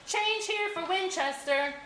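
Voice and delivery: high, called-out stop announcement; station announcer. A narrator's reading. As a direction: call the stop like a conductor. Whale announces stop like conductor.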